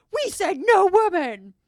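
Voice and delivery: slow, high mock shout; protesting guy. Guy objects to mom at guys' day, indignant shout. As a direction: loudly lay down the house rule, hammed-up. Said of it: "And then the guy's like".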